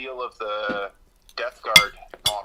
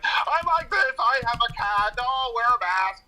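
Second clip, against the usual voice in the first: much higher, louder silly voice of random chatters; mocking chat voice; 11 semitones higher, 6 dB louder than usual. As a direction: parody dumb stream comments in a squeaky voice. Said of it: "It's just like".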